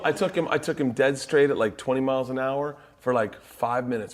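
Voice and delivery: usual speaking voice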